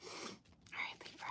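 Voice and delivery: breathy unvoiced whisper to herself; whispered aside. Real, not acted. Reader whispering to herself about her curly hair, hushed aside. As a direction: whisper it off to the side, half to yourself.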